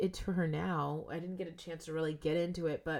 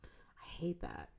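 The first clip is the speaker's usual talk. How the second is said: normal talking voice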